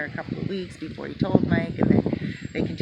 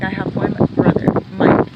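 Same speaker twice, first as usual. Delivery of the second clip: much louder, exasperated outburst; loud retort; 14 dB louder than usual. Retold or imitated. Sister retorting to Jose about her brother, loud and exasperated. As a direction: belt it out like an annoyed sister. Girlfriend's outburst retold loudly.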